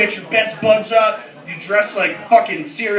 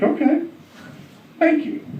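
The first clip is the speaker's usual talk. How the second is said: tentative acceptance; 8 semitones higher, 3 dB quieter than usual. Retold or imitated. Taken-aback reply to kid, acted.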